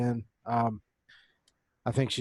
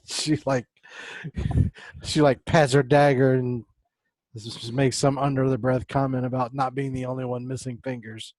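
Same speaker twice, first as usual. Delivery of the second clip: ordinary delivery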